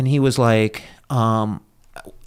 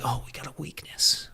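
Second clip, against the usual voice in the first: retold instructor's hushed jab; mock whisper; 3 semitones higher, 11 dB quieter than usual. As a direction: whisper it like a taunt behind his back. Instructors mocking the weak recruit.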